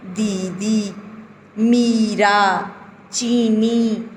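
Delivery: दीदी, मीरा, चीनी with the ई held long; teacher drawing out the long ई; long ई drawn out. Shown as a demonstration. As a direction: stretch each ई in दीदी, मीरा, चीनी slowly. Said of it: "हम खींच के बोल रहे हैं ई को".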